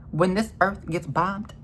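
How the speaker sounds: ordinary delivery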